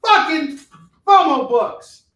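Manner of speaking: high, loud hammed-up threatening shout; mock Samuel L. Jackson rant. Host imagining the actor's thoughts to viewers, mock shout. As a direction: bellow like a furious movie badass. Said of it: "you'd be like".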